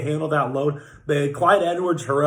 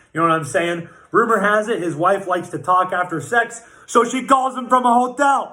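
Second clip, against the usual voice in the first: usual speaking voice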